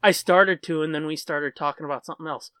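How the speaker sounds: ordinary delivery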